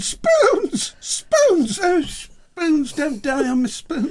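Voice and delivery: high, hammed-up sobbing wail; high mock lament. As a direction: wail about the spoons like a heartbroken child. Mock wailing over spoons.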